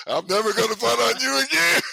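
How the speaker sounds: high-pitched, hammed-up rookie surrender; meek mock compliance. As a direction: squeak out a cowed promise for laughs. Floored rookie answers angry pitcher.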